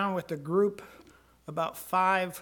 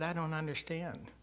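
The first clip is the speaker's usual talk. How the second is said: quiet confusion; 7 dB quieter than usual. Retold or imitated. Quiet reply to God.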